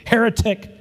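hammed-up accusing cry; mock scream. Path builder yells at firstborn, a mock scream. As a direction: fling the word like the rock. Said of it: "screamed the path builder".

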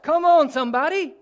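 loud, high call to the congregation. Real, not acted. Urging congregation to answer.

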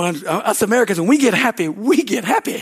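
ordinary delivery

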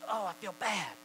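a touch of regret; accusing conscience. Acted. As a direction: play a guilty thought lightly. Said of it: "their conflicting thoughts accuse".

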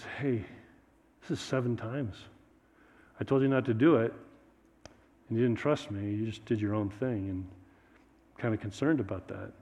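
low, soft disappointed words; soft concerned rebuke. Acted. God concerned after seventh time.

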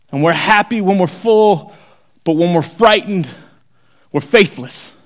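fiery sermon climax; preaching at full force. Acted. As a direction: build the appeal to a near-shout.

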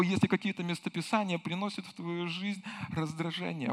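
normal talking voice

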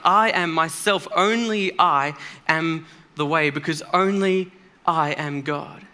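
slow, with "only" hit hard in "Only I am the way"; deliberate preacher's stress on "I" and "only"; emphatic. Acted. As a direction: drag out "I am myself" and punch each "only". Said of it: "emphatically and unmistakably revealing himself".

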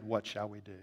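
almost whispered, dropped right down; hushed question. Acted. Preacher voicing the convicted crowd, very hushed. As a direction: barely breathe the question.

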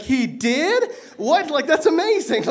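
high, loud, amazed gasping questions; excited teen. Retold or imitated. Teen hearing about miracles, excited and amazed. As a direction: squeal with wide-eyed wonder at the news. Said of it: "And I was so excited".

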